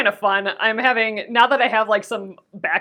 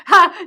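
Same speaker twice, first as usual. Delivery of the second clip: bright laugh then Ha; laughing burst; 9 semitones higher, 10 dB louder than usual. Real, not acted. Laughs at her own idea.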